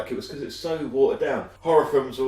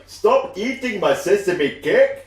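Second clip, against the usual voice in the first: character impression; 9 semitones higher, 7 dB louder, much slower than usual. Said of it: "Where he's like".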